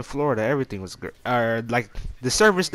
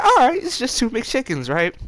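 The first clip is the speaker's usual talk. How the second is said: high mock voice; 11 semitones higher, 5 dB louder than usual. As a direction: quote it high and loud, mock casual. Retelling his casual thought.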